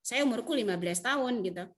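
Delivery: usual speaking voice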